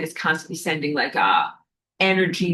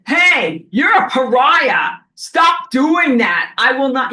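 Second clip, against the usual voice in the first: loud, high shout, stop doing that slow and hammered; shouting down a peptide user in your community; angry callout; 6 semitones higher, 7 dB louder, slower than usual. Shown as a demonstration. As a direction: call out the pariah with full force. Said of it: "use your fucking words and say".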